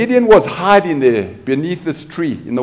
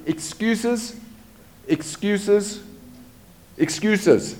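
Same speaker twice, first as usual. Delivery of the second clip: mocking excuses; 6 semitones higher, 8 dB quieter, much slower than usual. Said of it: "repeated mocking word".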